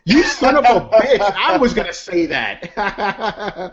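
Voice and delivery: high, loud, slow laughing protest; laughing outrage. Real, not acted. Host laughing as his cohost steals his sign-off. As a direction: laugh out a fake curse.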